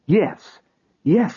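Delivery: grab at the hope; eager agreement. A narrator's reading.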